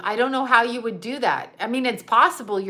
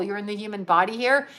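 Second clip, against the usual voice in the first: ordinary delivery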